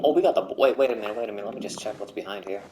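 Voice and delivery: normal talking voice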